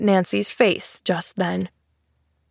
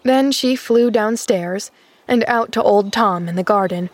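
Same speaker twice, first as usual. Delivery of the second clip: ordinary delivery, nothing marked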